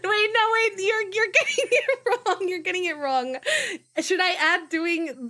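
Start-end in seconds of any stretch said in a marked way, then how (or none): 0.0-2.5 high, loud laughing objection; laughing protest. Real, not acted. Streamer laughing at chat, laughing protest. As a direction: laugh while insisting they got it wrong.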